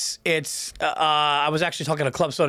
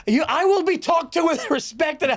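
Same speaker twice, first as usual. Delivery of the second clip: Will Ferrell sketch bit; 11 semitones higher than usual. Retold or imitated. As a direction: bellow it like an offended self-important man. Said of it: "that one sketch where he's like".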